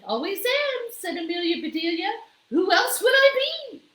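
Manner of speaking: high, bright-eyed character voice; the maid proudly explaining to the mayor, high and loud; Amelia Bedelia. A narrator's reading. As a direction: say it high and cheerful.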